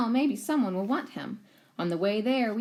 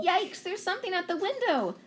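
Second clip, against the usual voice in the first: startled; 11 semitones higher than usual. Acted. Startled at night.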